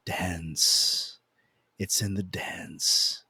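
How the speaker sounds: slow, low and hushed, drawing out the words; lightly put-on mystical dance-lady voice; flowy seance woman. Woman at a seance explaining her dance, imitated soft and slow. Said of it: "like, really flowy".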